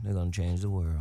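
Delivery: low-pitched fatherly assurance; fatherly pronouncement. Real, not acted. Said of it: "Now you sound like my father".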